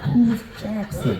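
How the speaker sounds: high, slow confused question; puzzled kid asking who that is; baffled kid. Retold or imitated. Son confused by his mother's made-up names. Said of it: "And I was like".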